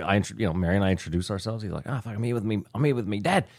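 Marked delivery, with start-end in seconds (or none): 1.9-3.5 a lightly voiced Australian bloke; Australian accent. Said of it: "So he's clearly Australian".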